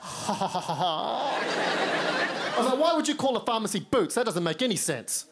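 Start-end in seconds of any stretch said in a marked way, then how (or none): none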